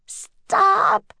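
high-pitched urgent cry; girl's cry. A narrator's reading. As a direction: cry out high to halt them. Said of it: "cried Annie".

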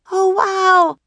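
very high, loud cry of amazement; Annie crying out. A narrator's reading. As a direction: exclaim it high and loud in wonder. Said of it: "cried Annie".

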